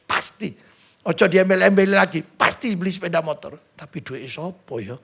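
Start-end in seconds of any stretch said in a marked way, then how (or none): 3.7-4.9 soft, low doubtful mutter; doubting aside. Retold or imitated. Preacher mocks a believer doubting his own prayer, muttered. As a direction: mutter the doubt under your breath.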